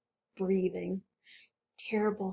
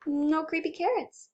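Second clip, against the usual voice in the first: plain reading voice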